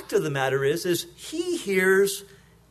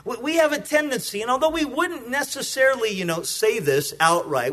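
ordinary delivery

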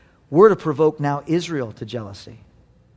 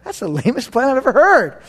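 higher, louder dismissive jab; mock scorn; 8 semitones higher, 4 dB louder than usual. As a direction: scoff playfully. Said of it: "I'm like".

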